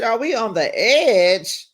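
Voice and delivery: high, loud, teasing breakup line; hammed-up are we breaking up; mock dramatic question. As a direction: ask it with camp drama. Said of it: "I said".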